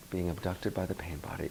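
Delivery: soft, slow, weighty repeat of "Being abducted by the pain body". Shown as a demonstration. Coach repeats his term to the room, soft and slow. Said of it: "I call it being abducted".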